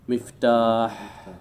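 مفتاح stretched slowly, each sound clear; slow drawn-out model word. Shown as a demonstration. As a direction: spell out مفتاح slowly for the class. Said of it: "مثل إيش؟". (نطق مفتاح ببطء وتمهّل)

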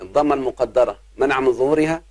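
ordinary delivery

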